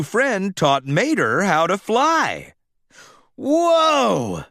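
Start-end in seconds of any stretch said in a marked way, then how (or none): 3.4-4.4 thrilled whoa. A narrator's reading. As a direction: shout it as you take off. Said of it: "the tow truck exclaimed".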